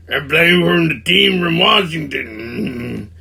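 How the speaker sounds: slow, dim-witted drawl; mock slow-witted player; Sling Blade voice. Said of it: "like they're all fucking a bunch of sling blades".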